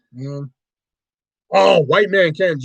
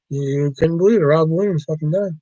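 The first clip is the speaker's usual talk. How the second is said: normal talking voice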